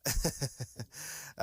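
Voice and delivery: embarrassed laugh. Real, not acted. Laughs at own failed accent.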